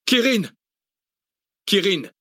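high-pitched falling shout; loud rallying cry. A narrator's reading. Kurdish woman leads her people's war chant, shouting. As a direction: cry it out, then echo it. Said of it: "La voix forte d'Esther".

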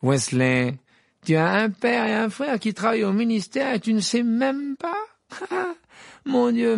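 high, sneering mock voice; Malfoy jeering at Weasley's father; taunting Malfoy. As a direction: rub it in with a smug laugh.

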